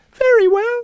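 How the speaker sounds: tiny queen consenting; mouse queen. A narrator's reading. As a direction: regal squeak.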